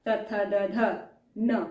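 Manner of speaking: त, थ, द, ध spaced out very slowly, one by one; slow, deliberate drill of त, थ, द, ध; very slow demo. Shown as a demonstration. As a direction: say त, थ, द, ध very slowly for learners. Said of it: "दंत से touch करके बोल रहे हैं".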